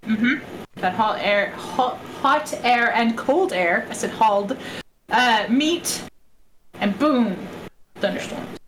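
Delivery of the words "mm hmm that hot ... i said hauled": ordinary talk, nothing marked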